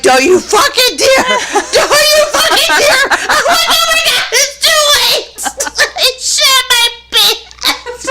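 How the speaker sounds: very high squeal through laughter; hysterical laughing retelling. Retold or imitated. Retelling son's warning and her accident, shrieking with laughter. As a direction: act out the panic while helpless with laughter.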